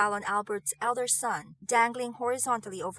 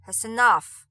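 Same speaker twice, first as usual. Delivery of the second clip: much louder, cutting them off; stern command; 10 dB louder than usual. Acted. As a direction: shut the argument down hard. Nigel ending the bickering.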